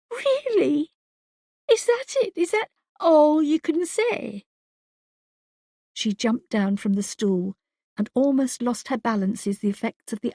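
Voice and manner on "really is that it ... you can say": high, sharp disbelief; incredulous outrage. A narrator's reading. Wife scorns husband's cold reply, high and incredulous. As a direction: throw his words back at him.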